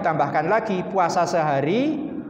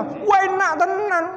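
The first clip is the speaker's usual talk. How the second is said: hammed-up delight. Diner raving over two plates of food. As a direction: rave about the food.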